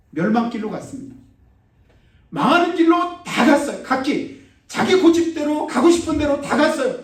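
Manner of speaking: high, loud, impassioned sermon; full-force preaching. Acted. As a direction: drive the warning home to the congregation.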